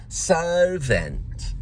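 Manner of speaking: "servant" stretched slowly, London style; elongated London word. Retold or imitated. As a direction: chew and elongate the first vowel of "servant". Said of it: "she chews certain words".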